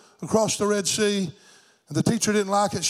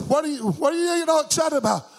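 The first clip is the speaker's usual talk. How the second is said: high-pitched, startled teacher's question; acted bewildered question; 8 semitones higher than usual. Teacher questions the whooping boy, high startled voice. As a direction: ask it high, as if taken aback.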